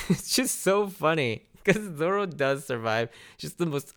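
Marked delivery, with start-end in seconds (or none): 0.1-1.4 laughing. Real, not acted. Laughing at the scene.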